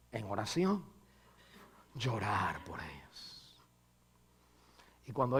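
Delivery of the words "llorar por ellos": hushed whisper on the point; whispered sermon point. Real, not acted. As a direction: lean in and breathe it. Preacher drops to whisper.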